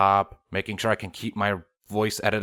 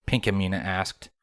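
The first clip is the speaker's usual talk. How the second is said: ordinary delivery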